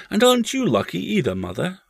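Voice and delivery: put-on high, quick child's question; young boy. A narrator's reading. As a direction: hurry it out as a small boy. Boy questions his mother.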